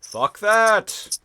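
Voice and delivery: high, loud retold shout; defiant outburst. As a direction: yell it in mock defiance.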